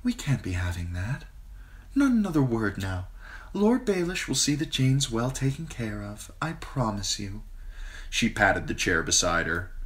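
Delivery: put-on a queen cooing to a frightened girl; queen Cersei, sweet and smooth. A narrator's reading. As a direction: Cersei soothing Sansa in a honeyed voice. Narrator voices Queen Cersei.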